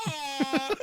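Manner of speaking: very high, wailing mock sob; hammed-up bawling through laughter; mock crying. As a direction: break into a loud, comic boo-hoo. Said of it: "Movies make me cry".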